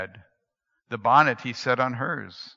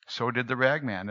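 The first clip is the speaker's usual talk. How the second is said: plain reading voice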